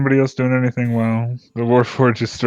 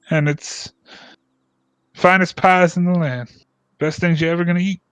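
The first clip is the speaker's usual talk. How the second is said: higher, eager village local; chatty villager; 3 semitones higher than usual. Acted. Villager explaining the pie contest to a stranger, proud and eager.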